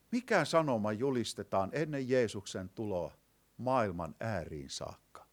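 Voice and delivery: soft, low-pitched question; understated, quiet rhetorical question; hushed question. Real, not acted. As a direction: ask it softly, letting the ending sink. Preacher poses question, then repeats it.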